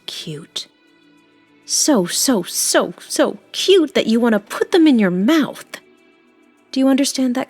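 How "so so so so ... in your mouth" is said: louder, higher, swelling adoration; unsettling gushing. Acted. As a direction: build into ravenous cuteness overload. Gushing over devourably cute kittens.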